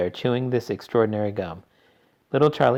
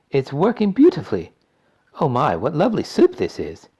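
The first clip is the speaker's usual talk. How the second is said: put-on delighted girl voice; 6 semitones higher, 6 dB louder than usual. A narrator's reading. Violet delighted.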